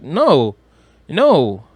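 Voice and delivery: ordinary talk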